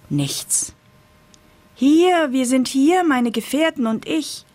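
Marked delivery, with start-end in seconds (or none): 1.7-4.4 high, eager pumpkin voice; a lightly voiced excited pumpkin.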